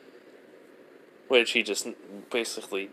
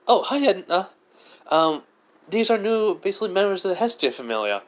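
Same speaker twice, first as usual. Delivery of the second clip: put-on high, bright-eyed boy's voice, quick at the end; a boy eagerly introducing his new friends; cheerful young adventurer; 9 semitones higher than usual. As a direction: Belle introducing his familia to Edna, in a high voice. Belle introducing new members to Edna.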